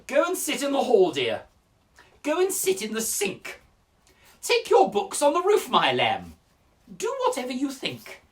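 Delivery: loud, high swoops falling on the endearments; exasperated teacher. Acted. Teacher fobbing off a complaining pupil, loud and exasperated. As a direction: answer the pupil with theatrical exasperation.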